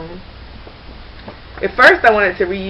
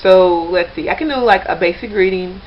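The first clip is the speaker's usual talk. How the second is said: normal talking voice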